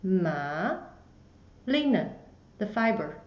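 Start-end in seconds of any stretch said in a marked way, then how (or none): 0.0-0.8 "ma" starting low and rising; second tone ma. Shown as a demonstration. Teacher repeating the second tone, "ma" rising. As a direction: swing "ma" up from low. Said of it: "it goes up".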